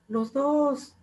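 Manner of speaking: very high squeaky hidden voice; high mock hidden voice. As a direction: squeak it from the back of the room. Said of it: "esa voz así oculta".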